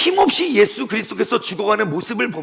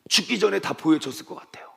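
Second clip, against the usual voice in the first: usual speaking voice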